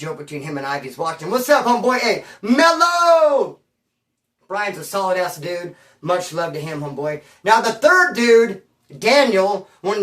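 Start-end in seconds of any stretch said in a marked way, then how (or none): 1.3-3.6 loud drawn-out call across the room. Real, not acted. Greeting his homeboy with a slow loud call. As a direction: hail him from a distance. Calling out to a friend.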